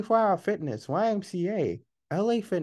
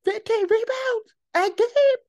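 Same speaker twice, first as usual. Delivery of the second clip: incredulous; 14 semitones higher, 7 dB louder, slower than usual. Real, not acted. Incredulous at a stat.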